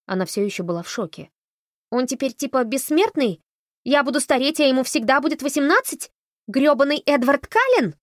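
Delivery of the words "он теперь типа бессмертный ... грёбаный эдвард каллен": loud, higher upset rant; indignant outburst. A narrator's reading. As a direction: protest at the unfairness. Upset girl protests loudly.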